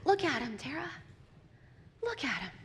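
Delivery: soft, hushed gentle command; quietly urge, with pauses between; voice of the Lord. Acted. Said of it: "I hear the voice of the Lord".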